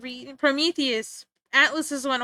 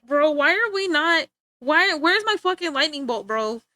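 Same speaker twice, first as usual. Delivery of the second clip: raised, louder exasperated bro-talk; hammed-up annoyance, easing on the last word; mock pissed-off Zeus; 4 semitones higher, 5 dB louder than usual. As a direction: gripe as a fed-up god, playing it for comedy. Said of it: "Zeus is just chronically pissed off".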